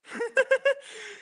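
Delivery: very high, squealing laugh; high-pitched giggle. Real, not acted. Laughs after greeting the camera.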